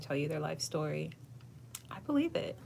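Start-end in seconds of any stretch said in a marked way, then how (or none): none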